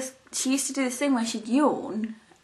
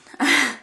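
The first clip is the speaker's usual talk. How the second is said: short laugh; 4 semitones higher, 6 dB louder than usual. Real, not acted. Laughing at own remark.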